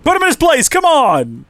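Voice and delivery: high, loud urging shouts; cheering yell. Real, not acted. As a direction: egg the fighter on at full voice. Cheering character during fight.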